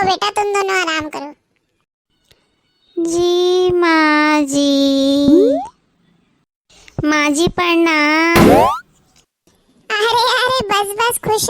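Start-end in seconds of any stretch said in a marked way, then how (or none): 3.0-8.5 drawn-out, lilting greeting; sing-song slow bride. Acted. New bride greeting her mother-in-law, slow sing-song. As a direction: stretch each word like a shy new bride.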